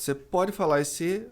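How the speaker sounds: usual speaking voice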